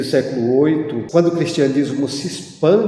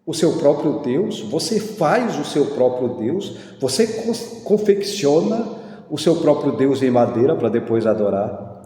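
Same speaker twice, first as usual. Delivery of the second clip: ordinary delivery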